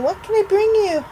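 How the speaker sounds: high, loud mock-solicitous question; mimicking fussing callers. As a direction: parrot their pestering offer of help. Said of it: "People keep ringing me and saying".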